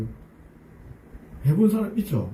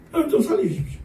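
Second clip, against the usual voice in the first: a high, stronger cry for rescue, imitating weeping; fuller tearful plea; 6 semitones higher, faster than usual. 왕이 하나님께 통곡하며 높고 큰 소리로 애원하는. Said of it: "눈물 막 펑펑 쏟아내는 거예요".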